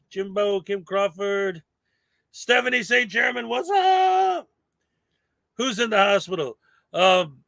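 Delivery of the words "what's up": squeaky high what's up; excited greeting. Real, not acted. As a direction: toss it up high and bright. Chat shout-out greeting.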